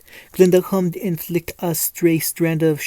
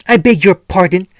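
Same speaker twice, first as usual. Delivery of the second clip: loud, affronted protest; indignant outburst; angry indignation; 8 dB louder than usual. A narrator's reading. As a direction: exclaim it in offended outrage. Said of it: "angry and flushed".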